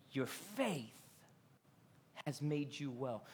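hushed, low and gentle; soft blessing spoken close; tender Jesus. Acted. Jesus to the healed woman, hushed and tender.

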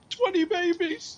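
high, loud, slow cry through laughter; laughing shout. Retold or imitated. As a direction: howl it out in disbelief. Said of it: "laughing, going".

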